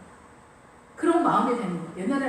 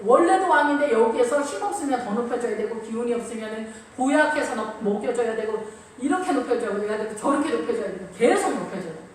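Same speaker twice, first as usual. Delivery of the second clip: usual speaking voice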